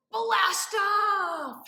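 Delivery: loud high blast-off cry; excited shout. Shown as a demonstration. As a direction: launch it with a big shout. Rocket launch shout.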